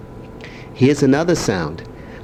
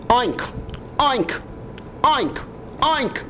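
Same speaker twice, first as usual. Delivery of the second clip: higher, softening oinks; pig oink; 10 semitones higher, 6 dB quieter, slower than usual. Shown as a demonstration. Grandpa oinking for his grandson. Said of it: "What's a pig sound like?".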